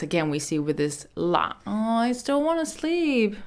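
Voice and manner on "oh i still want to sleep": whiny, higher-pitched plea to stay in bed; emphatic sleepy protest. Acted. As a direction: moan it like someone refusing to get up. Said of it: "Bit more emphasis".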